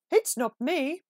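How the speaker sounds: a lightly hooted owl voice; hooting owl. A narrator's reading. Narrator voicing the owl answering Peep, lightly hooting. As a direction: hoot it out like an owl. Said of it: "hooted the owl".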